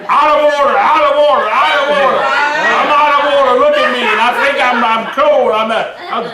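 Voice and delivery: high, hammed-up shouted tirade; mock shouting. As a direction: imitate the bishop's own ranting at the boy. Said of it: "he was so shouting at me".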